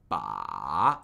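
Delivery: "ba" dipping very low, then rising; deep falling-rising "ba"; third tone. Shown as a demonstration. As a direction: dip "ba" to the bottom and swing it up. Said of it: "falling and rising".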